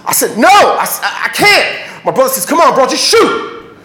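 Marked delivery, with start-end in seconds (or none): 0.4-2.0 panicked refusal. Retold or imitated. Frozen kid refusing to shoot. 2.4-3.7 high, insistent retold yell; urgent goading. Brother presses the kid to fire, urgent yelling.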